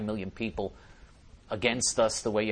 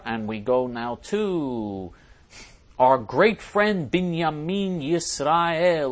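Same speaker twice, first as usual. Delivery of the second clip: slow, stretched introduction, louder on the name; drawn-out announcer intro; 4 semitones higher, 5 dB louder, much slower than usual. Real, not acted. Host introduces next commenter in a drawn-out announcer voice. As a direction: announce the next guest like a showman.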